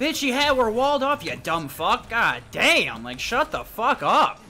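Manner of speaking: furious shouting at a teammate, loud and high; angry yelling. Real, not acted. Gamer raging at his teammate over voice chat, loud furious yelling. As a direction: yell it in real rage.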